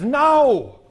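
high, drawn-out acted no; emphatic refusal. Lady at checkout refusing prayer, high acted refusal.

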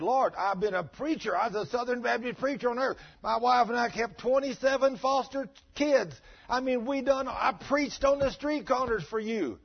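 high, loud, insistent pleading; a preacher defending himself to God, voiced high and loud. Preacher pleading his case to God.

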